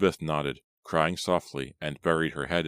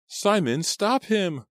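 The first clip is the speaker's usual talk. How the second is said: much higher, loud panicked shriek; terrified scream; 4 dB louder, slower than usual. A narrator's reading. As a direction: scream at him to stop the killer. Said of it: "Elizabeth's terrified cry".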